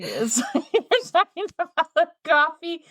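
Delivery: talking through laughter; giggling while speaking; laughing. Real, not acted. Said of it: "I'm laughing".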